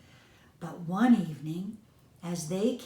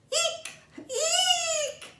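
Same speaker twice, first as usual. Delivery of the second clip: very high mouse squeak-laugh; squeaky laugh. A narrator's reading. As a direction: squeal with glee like a tiny mouse. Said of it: "laughed Fuzzytail".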